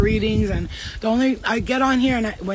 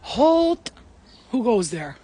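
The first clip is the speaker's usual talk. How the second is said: hammed-up challenge to a stranger; sentry's challenge; 2 semitones higher, much slower than usual. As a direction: call a sudden halt like a playful sentry. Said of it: "I'm just like".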